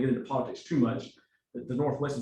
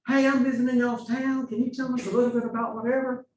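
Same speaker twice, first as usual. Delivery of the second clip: higher, louder, friendly Southern visitor voice; a hint of a Texas twang, pitched up and bright-voiced; Southern accent; 10 semitones higher, 4 dB louder than usual. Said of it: "someone with a different accent".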